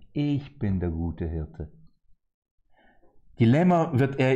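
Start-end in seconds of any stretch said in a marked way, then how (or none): 0.1-1.7 a hint of Jesus, lower, softer and slow; quietly lightly voiced Jesus saying he is the shepherd; Jesus speaking. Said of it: "Dann sagt Jesus".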